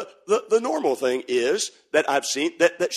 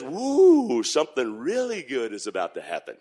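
put-on hushed amazement from the boy; Junior's voice; 5 semitones lower than usual. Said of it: "Junior looks ... and says".

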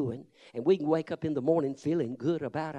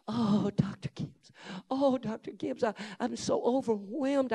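higher, breathless swooning female voice; gushing young woman; 6 semitones higher than usual. Retold or imitated. Young woman gushing over the visiting preacher, higher and swooning. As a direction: gush like a starstruck admirer.

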